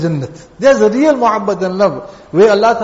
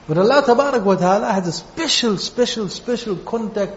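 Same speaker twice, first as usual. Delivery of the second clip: special repeated, getting softer each time; fading repeated special; 3 dB quieter than usual. Real, not acted. Tells listeners of Allah's connection, special fading softer. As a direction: let special fall away to near hush.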